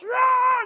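high-pitched imitated battle cry; mock warrior yell. Preacher imitates a guy charging down at altar call, mock yell. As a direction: let out a wild warrior's "aah", imitating him. Retold warrior runs down yelling.

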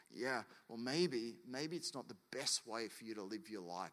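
low, softened tactful advice; gentle correction. Acted. God correcting a gossiping believer, gentle and low. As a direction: suggest it gently, pitch dropped.

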